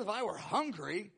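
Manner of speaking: a little sarcasm, playing God; measured, pointed sarcastic retort; divine sarcasm. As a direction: drily put the givers in their place. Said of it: "this is a little sarcasm".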